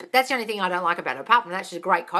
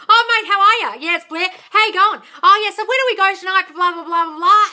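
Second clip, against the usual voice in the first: high, loud broad Australian chatter, imitated; fast Australian accent; 10 semitones higher, 9 dB louder than usual. Brother chatting to her fiancé on the phone, mock Australian accent. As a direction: rattle it off as a mock Aussie bloke. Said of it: "talking too fast in an Australian accent".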